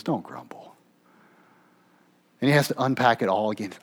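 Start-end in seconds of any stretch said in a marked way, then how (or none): 0.0-0.7 whispered, breathy warning; hushed rebuke. Acted. Jesus whispering to grumbling followers, hushed.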